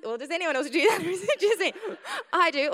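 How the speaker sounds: laughing while asking. Real, not acted. Asking the congregation, laughing. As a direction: giggle through the question.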